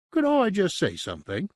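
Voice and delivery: high, fawning but smug demon; smug and obsequious. A narrator's reading. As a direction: wheedle the master with a smug edge, pitched high. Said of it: "obsequious, but unmistakably smug".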